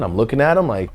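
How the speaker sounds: usual speaking voice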